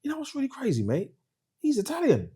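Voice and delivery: high, soft Cockney impression; Cockney guy. Cockney van controller explaining the driver to a caller, light-hearted high Cockney impression. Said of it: "He was a Cockney guy".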